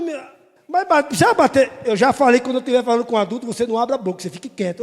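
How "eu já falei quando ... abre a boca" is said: normal talking voice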